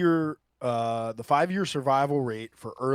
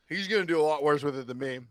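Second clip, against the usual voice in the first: normal talking voice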